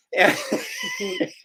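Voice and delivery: short high laugh; soft, high burst of laughter. Real, not acted. Storyteller amused by his own tale, short high laugh.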